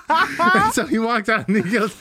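high giggly talk; laughing. Real, not acted. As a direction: giggle through it. Laughing while retelling.

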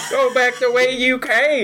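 high laughing harsh warning. Acted. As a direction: repeat the warning, cracking up. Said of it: "Another voice".

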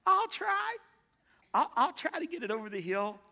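put-on very high, loud, squeaky-boy voice; Pee Wee Herman voice. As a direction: play the timid little engine as Pee Wee Herman. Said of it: "Sound like Pee Wee Herman".

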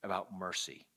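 father turning to mercy softly; gentle dad. Retold or imitated. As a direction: ease it in like a kind dad. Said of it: "He says".

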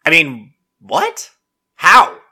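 mock astonished outrage; incredulous. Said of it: "And I'm like".

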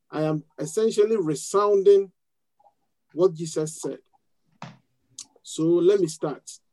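ordinary delivery

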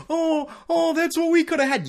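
very high, wailing put-on regret; mock lamenting fan. Podcaster imitating regretful fans watching Beckham win, high mock lament. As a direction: mimic a fan moaning over what was lost.

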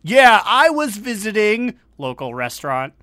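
loud, high pro wrestler promo. Retold or imitated. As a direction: hype it like a wrestler on the mic. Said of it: "like how wrestlers always go".

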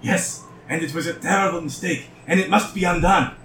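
a lightly voiced mad scientist; eccentric doctor voice. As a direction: lightly play the eager inventor. GM voices the Mind Flayer doctor.

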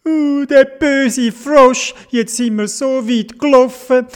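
high-pitched whiny little character; put-on high whinge; whining. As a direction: whine and moan in a high voice, ending in mock sobs. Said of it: "hat angefangen zu klönen".

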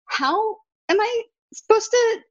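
3 plead at the absurd script, drawn out and high; 1 exasperated question. Retold or imitated. 4 actor complaining about a commercial self-tape, slow, high exasperation.

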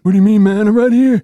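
loud, high-pitched copycat protest; John. Pretend. Host joining in impersonating absent player, loud high mock protest. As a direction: echo the impression, high and loud. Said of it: "That's what he would say".

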